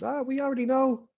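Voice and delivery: high-pitched sister, cutting in; Lily. Acted. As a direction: put on a high voice to expose a brother's act. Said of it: "and Lily goes".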